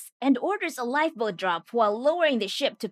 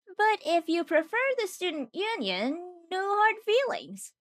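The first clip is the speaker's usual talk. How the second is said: acted tsundere; 8 semitones higher than usual. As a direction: lift the voice high, feigning indifference. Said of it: "Bro is a tsundere in the making".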